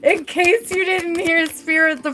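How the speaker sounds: giddy, stretched-out emphasis; gleeful birthday wish. Real, not acted. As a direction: announce it with giddy delight. Gleeful birthday wish between laughing fits.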